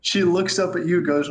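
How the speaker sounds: ordinary delivery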